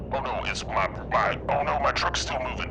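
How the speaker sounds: high, loud panicked cries. Real, not acted. Trucker watching own truck drift off, panicked. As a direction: yelp in rising alarm as it gets away.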